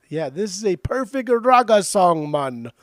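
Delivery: high, slow put-on Jamaican accent; bad Jamaican accent. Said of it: "Oh, the worst accent".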